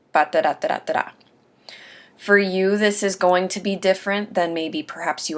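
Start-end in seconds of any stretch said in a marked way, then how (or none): 0.1-1.1 quick run of "ba-da-da" as placeholder speech; rattled-off filler syllables. Shown as a demonstration.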